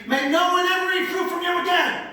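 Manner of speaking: high, loud pronouncement of a curse on "May no one ever eat fruit from you again"; fierce curse. Shown as a demonstration. Preacher voicing Jesus cursing the fig tree, loud and high. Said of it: "why am I saying it that way".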